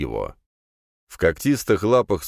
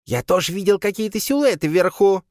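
high squeaky little creature; 14 semitones higher, 4 dB louder than usual. A narrator's reading. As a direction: squeak like a small beast. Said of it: "песклявый Крисп".

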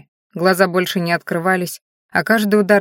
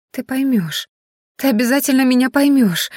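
higher-pitched desperate insistence; convulsive pleading; 6 semitones higher than usual. A narrator's reading. Tearful Rena begs to be understood, convulsive pleading. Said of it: "судорожно зашептала она".

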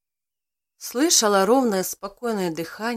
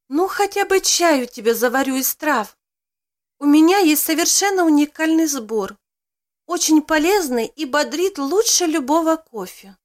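higher and louder, eager; 6 semitones higher, 6 dB louder than usual. Acted. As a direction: sell the herbal tea with enthusiasm. Woman eagerly offers herbal tea.

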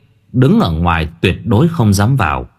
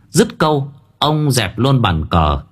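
ordinary delivery

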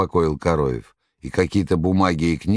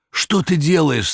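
higher, slow pained cry; anguished cry; 11 semitones higher, 4 dB louder than usual. Acted. The master crying out at Margarita, anguished. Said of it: "страдальчески прокричал мастер".

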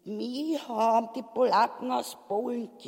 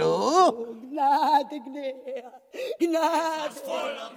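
flehend; 3 semitones higher, 4 dB louder, much slower than usual. Acted. Frau fleht erneut.

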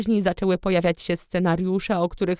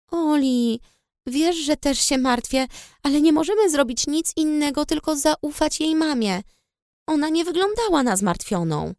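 put-on higher young woman's voice, pleading; worried sister; 7 semitones higher than usual. A narrator's reading. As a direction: reason with him, worried. Narrator voices worried Mia.

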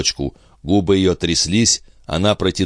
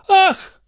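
very high, louder shriek of horror; a single horrified shriek; mother seeing the carriage hit, very high shriek; terrified scream; 5 dB louder than usual. Acted. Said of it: "Андре жутко вскрикнула".

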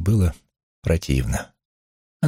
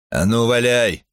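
raised, higher-pitched acted call; booming challenge; 6 semitones higher than usual. A narrator's reading. Gabe dares the hidden heckler, acted booming challenge. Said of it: "прогремел Гейб".

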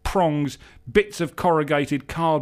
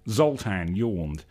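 usual speaking voice

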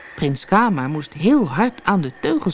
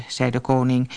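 ordinary delivery

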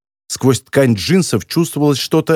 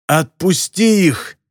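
slow, higher-pitched croaking demand; hoarse croak; 4 semitones higher, slower than usual. A narrator's reading. Captive girl demands her friends' release, hoarse croak. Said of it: "хрипло прокаркала она".

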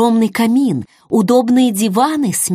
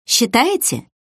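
higher-pitched, curious little question; sincere curiosity; 4 semitones higher than usual. A narrator's reading. Narrator questioning the host's opinion, sincerely curious. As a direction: ask as if you truly wonder. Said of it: "с искренним любопытством спросила я".

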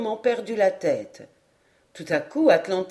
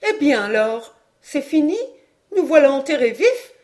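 higher, louder alarmed questions from a mother; lively interruption; 5 semitones higher, 5 dB louder than usual. A narrator's reading. Madame interrupts on hearing they are stuck, lively. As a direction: cut in sharply, pitch jumping up. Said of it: "interrompit vivement madame Caudal".

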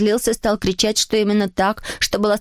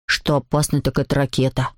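much lower know-it-all voice; opinionated schoolboy; 8 semitones lower than usual. A narrator's reading. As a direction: weigh in low, as the expert. Another boy weighs in.

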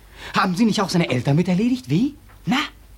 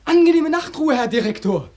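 mock polite farewell; 8 semitones higher than usual.

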